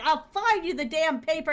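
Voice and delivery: high, loud retort; mock exasperated outburst; exasperated giving-in. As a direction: act out a worn-down printer snapping. Exasperated printer gives in.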